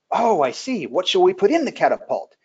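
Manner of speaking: a hammed-up puzzled king; naive king. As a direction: play the king as if he's catching on. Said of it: "he says".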